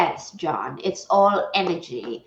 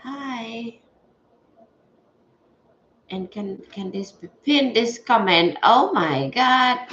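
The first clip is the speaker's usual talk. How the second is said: normal talking voice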